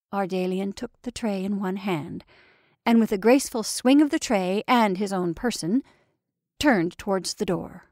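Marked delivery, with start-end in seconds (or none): none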